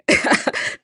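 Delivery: short breathy burst of laughter; real chuckle at a slip; self-amused laugh. Real, not acted. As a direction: laugh at your own clumsy phrase. Laughs at own bad English.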